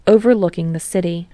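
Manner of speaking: usual speaking voice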